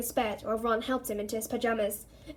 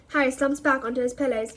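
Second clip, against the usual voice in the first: plain reading voice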